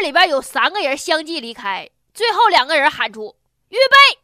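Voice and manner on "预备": sudden loud call to run; shouted command. Retold or imitated. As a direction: yell it like a starting signal.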